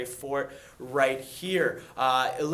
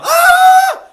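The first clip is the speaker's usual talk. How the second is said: piercing shout far higher and louder; rebel yell; 22 dB louder than usual. Retold or imitated. Ragtag rebels charging, wild rebel yell shout. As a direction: let out a wild battle cry. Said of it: "doing their rebel yell".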